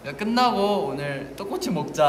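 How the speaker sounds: put-on playful kid voice. Acted kid eating ramen.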